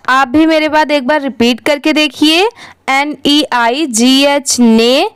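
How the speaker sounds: ordinary talk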